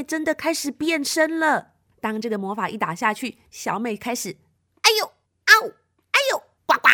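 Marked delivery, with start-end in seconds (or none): 4.8-6.9 high, loud yelps turning into frog croaks; squealed ows, then ribbit; transformation yelps and croak. Acted. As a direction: yelp as you morph into a frog.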